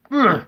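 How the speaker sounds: sharp high exasperated ugh; short annoyed grunt; frustrated groan. Real, not acted. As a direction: let out a fed-up groan. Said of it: "Sorry, I'm getting frustrated".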